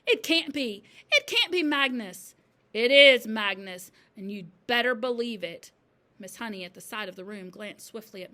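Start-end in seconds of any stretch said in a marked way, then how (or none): 0.1-2.3 loud, high, shaken denial; crying out. A narrator's reading. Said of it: "she cried". 2.7-5.7 loud, emphatic pronouncement; ghostly message. A narrator's reading. Ghostly chalk answers her denial.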